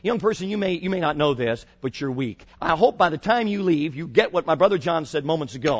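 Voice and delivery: usual speaking voice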